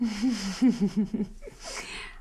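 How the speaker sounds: high, light chuckling laugh; airy run of chuckles. Acted. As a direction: chuckle along at your own remark. Dating testimonial joke at dinner.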